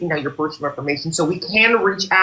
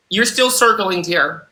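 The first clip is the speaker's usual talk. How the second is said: ordinary delivery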